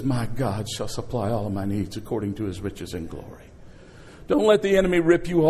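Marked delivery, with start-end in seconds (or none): 0.0-3.4 deep, soft scripture voice. Retold or imitated. Spirit of God testifies.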